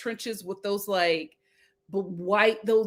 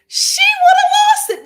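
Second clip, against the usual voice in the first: shrieked much higher and louder, half laughing; squealing laugh; 13 dB louder, faster than usual. Real, not acted. Picturing how Charity would react, shrieking with laughter. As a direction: lose it yourself on the repeat.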